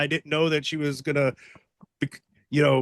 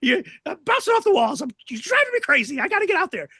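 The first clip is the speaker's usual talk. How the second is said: much higher, jittery begging; wired pleading; 11 semitones higher, faster than usual. Retold or imitated. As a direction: bounce off the walls with nerves. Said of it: "Ian's like".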